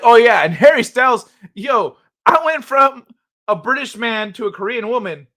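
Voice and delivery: hammed-up British accent, punchy and indignant; mock British influencer. Streamer imitating the influencer throwing [name] under the bus, mock British. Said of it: "He's like".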